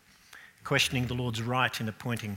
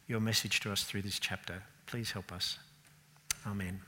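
prayer. Acted. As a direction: pray gently, fading toward amen. Leads a short prayer.

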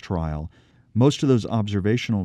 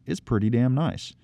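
ordinary talk, nothing marked

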